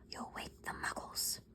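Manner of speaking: soft urgent whisper; hissed warning. A narrator's reading. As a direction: hiss the warning softly. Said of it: "hissed Professor McGonagall".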